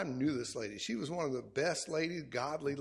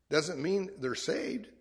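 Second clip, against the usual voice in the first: usual speaking voice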